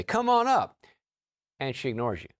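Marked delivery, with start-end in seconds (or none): 0.0-0.8 high, loud game-show call; big sing-out announcer's invitation; Bob Barker. Retold or imitated. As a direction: holler it like a show host summoning a contestant. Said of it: "like Bob Barker in the old, uh, days used to say".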